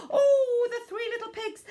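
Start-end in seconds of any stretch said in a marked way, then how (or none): 0.1-0.7 loud startled gasp, high; high alarmed gasp. Acted. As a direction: gasp in sudden fright. Pigs alarmed.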